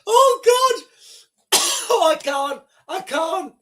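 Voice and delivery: high wail through laughter, with a cough; too far gone to carry on; laughed out, can barely speak; real helpless laughter. Real, not acted. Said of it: "I shouldn't be laughing".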